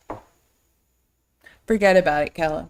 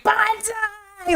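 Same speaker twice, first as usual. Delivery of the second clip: trucker's battle cry; 12 semitones higher than usual. Retold or imitated. Trucker going by in a cowboy hat, high mock cry.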